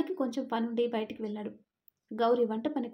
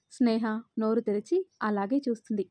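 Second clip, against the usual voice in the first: usual speaking voice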